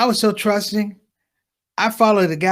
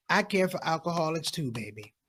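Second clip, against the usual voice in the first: sympathetic mom; 11 dB quieter than usual. Retold or imitated. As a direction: play a mom murmuring kind approval. Mom misunderstands, answers kindly.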